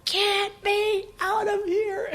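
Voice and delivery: high, drawn-out mock scream, held back in volume; stretched, high-pitched cry of frustration; retold frustrated scream. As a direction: act out a restrained scream, very slowly. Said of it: "I was so frustrated, and I did that".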